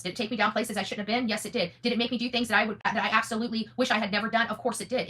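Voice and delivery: normal talking voice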